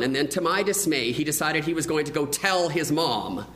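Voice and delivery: ordinary talk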